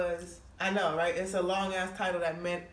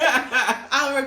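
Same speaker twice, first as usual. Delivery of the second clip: loud laugh; 4 semitones higher, 10 dB louder than usual. Real, not acted. Teller cracks up at herself.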